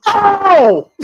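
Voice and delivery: high, loud "Oh!"; imitated pain scream. Co-host imitating Jason in pain, high scream. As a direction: mimic Jason crying out in pain. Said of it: "when he screams in pain".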